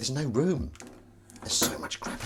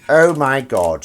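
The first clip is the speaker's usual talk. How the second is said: disgusted exclamation; 4 semitones higher, 10 dB louder, slower than usual. Real, not acted. Disgust at the messy sink.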